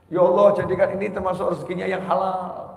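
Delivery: ordinary delivery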